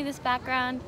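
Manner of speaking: usual speaking voice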